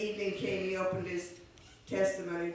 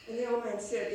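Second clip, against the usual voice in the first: usual speaking voice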